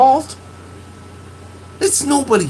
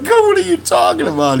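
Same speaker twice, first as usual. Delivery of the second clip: mock surprise; 12 semitones higher, 5 dB louder, much faster than usual. Retelling his own reaction.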